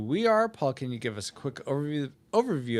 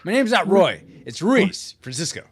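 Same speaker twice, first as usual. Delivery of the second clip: loud, raised-pitch protest; indignant correction; 5 semitones higher, 8 dB louder than usual. Acted. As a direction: insist on your real name, loudly. Mock indignant correction of wrong name.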